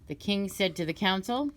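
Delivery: ordinary delivery, nothing marked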